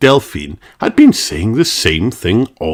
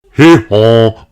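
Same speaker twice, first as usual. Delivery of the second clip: loud "Hee haw"; donkey greeting; donkey bray; 7 dB louder than usual. Acted.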